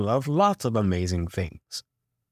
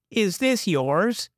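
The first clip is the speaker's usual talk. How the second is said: slow, high neighbour's question; drawn out, pointed question; 9 semitones higher, slower than usual. A narrator's reading. Neighbour holding up garbage, slow high question. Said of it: "Missus Grenly asked".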